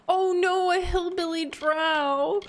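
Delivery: high, drawn-out playful dismay; gasp, then high exaggerated sympathy; cooing mock pity. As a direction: coo over it in a high, slow voice. Reacting to a hillbilly drow.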